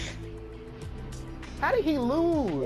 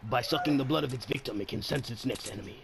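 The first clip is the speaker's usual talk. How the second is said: documentary narrator; 10 semitones lower, 5 dB quieter than usual. Acted.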